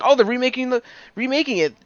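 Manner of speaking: high-pitched retold excitement; reenacting an excited first reaction. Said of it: "I got excited".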